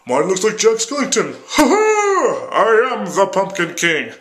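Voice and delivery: high, loud, theatrical character voice with a cackle; hammed-up cartoon villain boast; Pumpkin King voice. Playing Jack Skellington.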